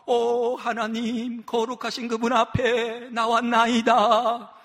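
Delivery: very slow, sing-song mock prayer; hammed-up holy intoning; affected piety. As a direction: draw it out like a sanctimonious hypocrite. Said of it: "가식 떨고 위선 떨면서".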